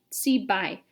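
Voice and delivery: plain reading voice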